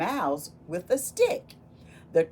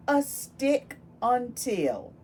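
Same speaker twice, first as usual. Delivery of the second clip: slow title read; 4 semitones higher, 4 dB louder, slower than usual. Acted. Announcing the book title.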